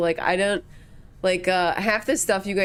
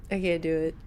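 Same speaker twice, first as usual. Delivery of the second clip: ordinary talk, nothing marked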